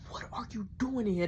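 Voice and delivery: breathy hushing whisper so mama stays asleep; urgent whisper. Retold or imitated. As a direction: shush him in a tight whisper. Whispering at night.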